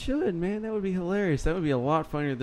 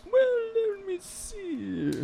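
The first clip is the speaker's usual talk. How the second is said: squeaky put-on voice; much slower than usual. Sudden put-on voice.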